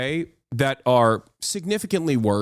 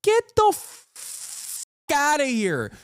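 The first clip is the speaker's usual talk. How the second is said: high, scornful "get the f- outta here"; pitched-up scoffing brush-off; disgusted outburst; 10 semitones higher, slower than usual. Real, not acted. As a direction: dismiss them with disgust. Ranting at critics.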